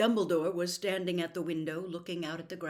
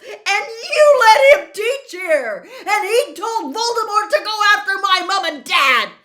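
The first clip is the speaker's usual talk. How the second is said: screaming, much higher and louder, near tears; uncontrolled yell; 13 semitones higher, 18 dB louder than usual. Acted. Harry screaming at Dumbledore for trusting Snape, breaking furious yell. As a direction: explode at him, voice cracking. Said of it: "he could not stop himself".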